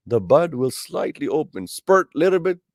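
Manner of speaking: ordinary delivery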